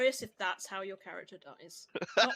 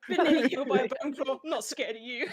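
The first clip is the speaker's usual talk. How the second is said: louder, higher mocking monster voice breaking into a laugh; bone claw taunt; 4 semitones higher, 8 dB louder than usual. Player jokingly voices the bone claw jeering, laughing. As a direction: ham up the creature's jeer.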